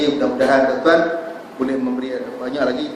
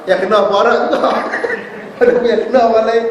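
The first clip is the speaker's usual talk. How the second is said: louder, higher, breaking into laughter; laughing punchline; 9 semitones higher, 5 dB louder, faster than usual. Real, not acted. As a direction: crack up while telling it. Laughs at own stoning anecdote. (ketawa sambil bercerita)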